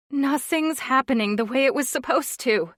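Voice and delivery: put-on a shaky, upset young wife; wobbly voice. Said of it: "she said in a wobbly voice".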